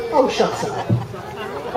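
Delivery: slow, breathy whisper; a slow mock-weary whisper; whispered brush-off. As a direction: mutter it dismissively under your breath. Jesus brushing off disciples.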